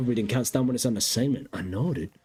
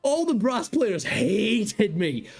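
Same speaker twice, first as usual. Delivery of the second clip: laughing; 13 semitones higher, slower than usual. Real, not acted. Laughing while telling story.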